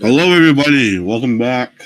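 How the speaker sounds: loud, upbeat host greeting; stream host intro. Acted. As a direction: open the show like a host. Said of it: "show intro".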